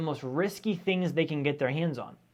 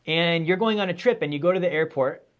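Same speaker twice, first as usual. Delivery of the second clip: usual speaking voice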